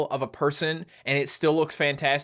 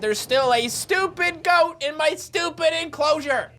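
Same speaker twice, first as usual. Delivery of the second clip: exasperated; 10 semitones higher, slower than usual. Acted. Rants about goat again.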